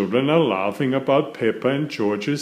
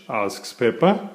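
ordinary delivery, nothing marked

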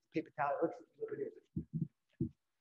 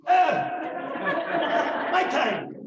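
surprised cry; 11 semitones higher, 10 dB louder, much slower than usual. Real, not acted. Reacts to time signal.

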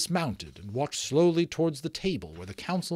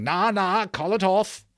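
high, loud rustic pleading; panicky protest; 8 semitones higher, 7 dB louder than usual. A narrator's reading. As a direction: beg for the spell to stop.

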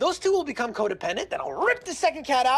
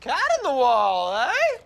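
intrigued eagerness; 9 semitones higher than usual. Acted. Cat expert relishes the job.

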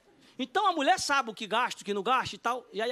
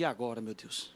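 very low, soft acted worry; retold quiet dread; dismayed aside; 12 semitones lower, 8 dB quieter than usual. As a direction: murmur it helplessly to yourself. Retold dismay at rival's bid.

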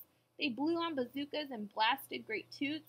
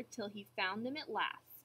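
usual speaking voice

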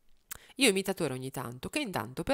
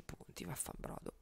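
muttered curse; 15 dB quieter than usual. Real, not acted. Frustrated aside.

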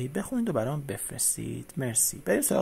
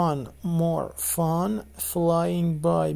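slow line reading; much slower than usual. Shown as a demonstration. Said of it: "repeats song line slowly".

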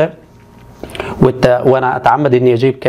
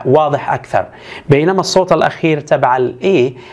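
normal talking voice

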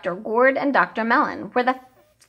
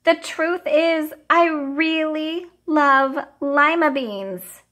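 slow sheepish confession; 6 semitones higher, slower than usual. A narrator's reading. Child confessing.

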